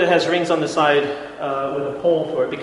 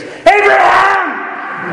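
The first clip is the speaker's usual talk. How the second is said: "Abraham!" yelled much higher and louder, stretched long; shouted name; 9 dB louder, much slower than usual. Shown as a demonstration. Heaven stops Abraham's knife with a drawn-out, very high, very loud yell. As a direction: bellow "Abraham!" as an urgent cry from heaven. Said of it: "So exclamation point".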